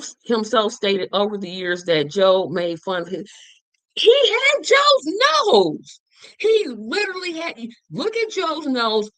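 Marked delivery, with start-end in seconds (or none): 4.0-9.1 emphatic outburst. Real, not acted. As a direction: drag it out, exasperated and insistent. Insisting on the family resemblance.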